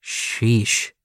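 usual speaking voice

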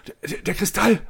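high, stammering in disbelief; stunned gasp. A narrator's reading. Scientist sees the crystal, stammering in shock. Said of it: "ächzt er fassungslos".

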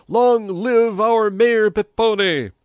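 slow, high, loud crowd chant; drawn-out hurrahing yell; yelled acclamation. A narrator's reading. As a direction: cheer the mayor at full voice. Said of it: "yelled the crowd".

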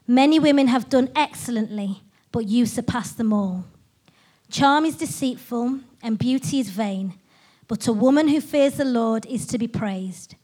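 normal talking voice

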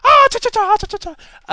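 high, loud chattering leprechaun noise; Simpsons leprechaun. Retold or imitated. Mimicking the Simpsons leprechaun for the audience, high chattering voice. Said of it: "I'm not gonna try an Irish accent".

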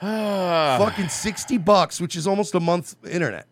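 loud, slow exasperated complaint. Real, not acted. Comedian venting to cohost about the fee, loud and slow.

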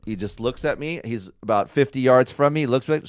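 ordinary talk, nothing marked

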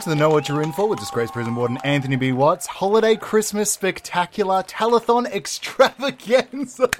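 big showy host opening; announcer voice. Acted. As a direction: boom it out like a telethon host. Telethon host opening, announcer style.

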